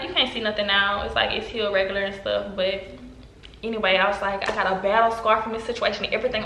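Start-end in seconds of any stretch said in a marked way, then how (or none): none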